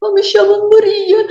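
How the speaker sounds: put-on high, choked voice faking a Portuguese accent; a terrified girl putting on a Portuguese accent. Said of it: "com sotaque de português, aí a voz nem saía".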